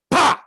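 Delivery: mouth-made gunshot pop; gunshot sound. Retold or imitated. Man imitating film gunshot, mouth sound. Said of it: "the gunshot".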